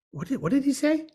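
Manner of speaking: confused high question; mock puzzled listener. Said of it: "decode it and say".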